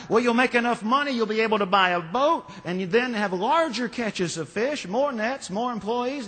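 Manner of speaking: high, fast, pushy salesman voice; pushy businessman. Acted. Businessman pressing the carefree fisherman, high and pushy. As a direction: lean in hard, selling the big dream.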